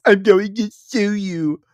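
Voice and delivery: laughing mimicry of her whiny threat; mocking laugh. Streamer mocking the clip's threat, laughing and high. Mocks clip quote with laugh.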